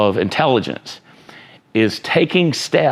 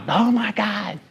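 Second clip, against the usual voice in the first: "Oh my God" squeaked out much higher and softer in mock panic; 12 semitones higher, 4 dB quieter, slower than usual. Coach imitating a panicked player, high mock panic. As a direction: play the panicking buyer, shrill with alarm. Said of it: "you weren't panicking".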